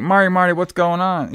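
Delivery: high-pitched mock panic; imitated freak-out. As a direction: lightly act out someone freaking out. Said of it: "Jenny starts freaking out a lot".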